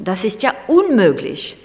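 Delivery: imitated exclamation, hammering the "un" of "unmöglich"; grandmother crying out. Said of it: "crying out often".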